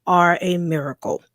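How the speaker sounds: ordinary talk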